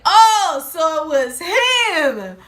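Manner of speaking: high, loud, slow oh of realization; shocked realization. Retold or imitated. Friends realizing the ex fired the shots, loud high shock. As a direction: cry out as it dawns on you. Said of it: "So we were like".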